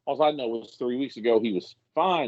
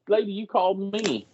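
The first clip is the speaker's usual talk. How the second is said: indignant retort; 10 semitones higher than usual. Retold or imitated. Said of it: "I'm like".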